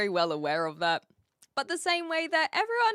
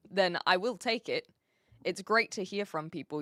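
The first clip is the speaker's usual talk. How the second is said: ordinary talk, nothing marked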